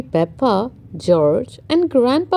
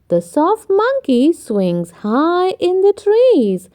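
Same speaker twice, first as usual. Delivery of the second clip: usual speaking voice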